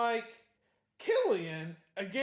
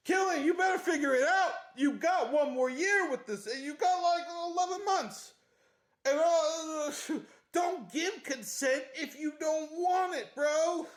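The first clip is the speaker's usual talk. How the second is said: high, loud playful telling-off; raised-voice comic warning; mock scolding; 8 semitones higher, 4 dB louder than usual. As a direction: lecture a fictional character in exasperation. Mock lecture to book character.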